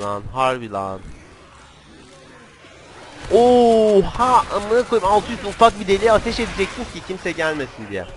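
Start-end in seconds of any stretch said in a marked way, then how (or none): 3.3-6.4 loud, high cry of amazement; shocked outburst. Real, not acted. Gamer startled by the game, loud shocked exclamation. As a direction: burst out in loud disbelief.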